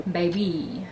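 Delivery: usual speaking voice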